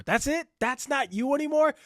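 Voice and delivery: high-pitched, indignant disbelief; incredulous. Real, not acted. Host reacting to a lame cop-out, incredulous.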